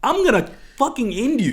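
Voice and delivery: high, loud outburst; angry threat. Acted. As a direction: threaten him in mock fury. Acted threat to the old man.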